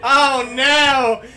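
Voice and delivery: milk it like a tragic newsreel; mock dramatic. Cohost mourning the lost weave, hammed-up loud lament.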